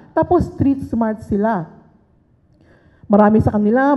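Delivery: ordinary delivery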